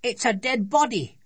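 put-on high-pitched child's cry of alarm; alarmed shout. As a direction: announce a horrifying find as a scared girl. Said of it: "shouted Sophie".

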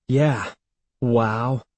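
dry sarcastic echo. A narrator's reading. Evan echoing Andy's wow, sarcastic. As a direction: repeat it with unimpressed irony. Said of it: "he repeated sarcastically".

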